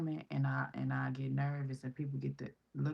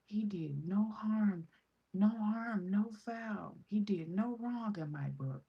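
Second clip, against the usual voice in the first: imitating a kind lady, whispered then higher; gentle reassuring lady; 6 semitones higher, slower than usual. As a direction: soothe like a kind stranger. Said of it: "She said".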